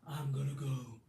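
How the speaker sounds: a hint of his deliberate delivery; spaced out slowly, lightly mimicking him; imitated slow deliberate voice. Retelling a movie scene to viewers, lightly imitating his halting delivery. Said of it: "he's like ... y'all know his voice".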